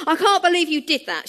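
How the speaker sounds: hurt disbelief through tears; tearful. Retold or imitated. Liz to her fiancé, tearful and upset. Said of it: "tears streaming down her face".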